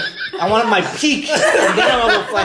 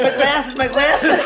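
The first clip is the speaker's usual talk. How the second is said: high, laughing cry for lost glasses; mock glasses-hunting panic; 7 semitones higher, slower than usual. Friend mimicking himself hunting lost glasses, mock panic. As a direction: play helpless and half-blind, laughing through it. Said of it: "felt like Bam on the head".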